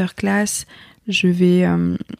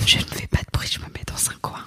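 whispered, shrinking into a corner; whispering while hiding; 4 dB quieter than usual. Retold or imitated. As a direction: whisper like you don't want to be noticed. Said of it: "Mais moi, je suis là".

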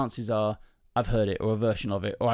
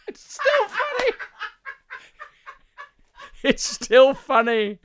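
high, squeaky words through real laughter; cracking up at your own joke; laughing; 3 dB louder, slower than usual. Real, not acted. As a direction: barely get it out for laughing.

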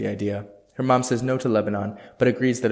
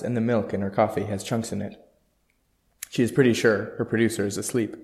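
ordinary delivery

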